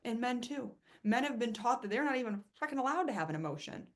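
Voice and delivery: normal talking voice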